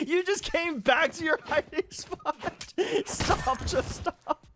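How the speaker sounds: high, slow, laughing scold. Real, not acted. Laughing at Net for blowing himself up, high and slow. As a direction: scold him through laughter, slowly. Mock-scolding a player who blew up.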